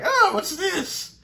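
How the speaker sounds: very high, slow, mock-innocent Tom Baker voice; Tom Baker's Doctor. Reviewer imitating Tom Baker pulling off the device, very high and slow. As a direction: act puzzled by the gadget as Tom Baker's Doctor. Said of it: "Just how he's like".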